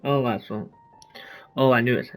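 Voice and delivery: usual speaking voice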